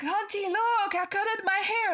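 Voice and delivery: put-on high, proud little boy showing off; little nephew voice. Aunt imitating her nephew bragging about his haircut in a high child's voice. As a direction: pitch it way up like a small child bragging.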